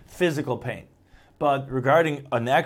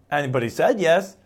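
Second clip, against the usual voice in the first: ordinary talk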